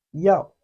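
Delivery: loud, drawn-out yao for students to copy; Chinese yao. Shown as a demonstration. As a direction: model yao clearly. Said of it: "Repeat after me".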